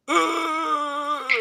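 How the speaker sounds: high, drawn-out groan of longing; a yearning ugh. Real, not acted. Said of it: "reacts to pirate fantasy".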